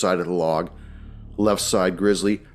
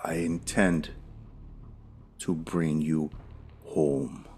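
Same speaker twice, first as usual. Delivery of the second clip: quieter, lower, creepy and fading; sinister whisper-like promise; 3 semitones lower, 8 dB quieter, slower than usual. Acted. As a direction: let the threat sink to a hush. Villain's line fades lower.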